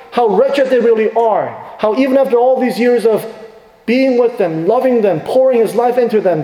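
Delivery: impassioned sermon climax; fervent preaching. Acted. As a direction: lift the room with rising conviction. Preaching at full force.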